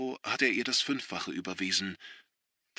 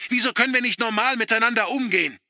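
much higher, loud, fast yell; desperate shout; 11 semitones higher, 7 dB louder, faster than usual. A narrator's reading. Son shouting at father in despair. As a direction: hurl the question at him.

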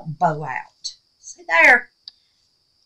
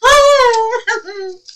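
squealing laugh of delight; a high giddy squeal over a gift; 3 dB louder than usual. Real, not acted.

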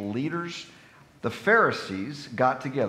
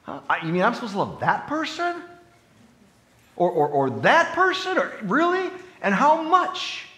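high, incredulous whining questions; mock confused believer; 10 semitones higher, 4 dB louder than usual. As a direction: act out someone baffled at who to love. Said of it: "See how complicated we make it?".

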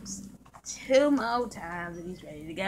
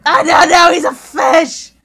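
shocked shout; 6 semitones higher, 13 dB louder than usual. Acted.

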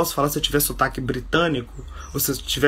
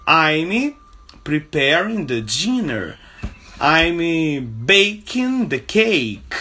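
slow teaching model; 3 semitones higher, much slower than usual. Shown as a demonstration. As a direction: drill them slowly for learners.

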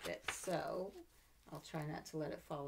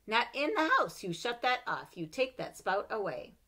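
higher stern mom; scolding mother; 6 semitones higher, 9 dB louder than usual. Acted. Mother forbidding the whale spout in the house, stern. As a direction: snap the order like a cross mother. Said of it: "what she would say".

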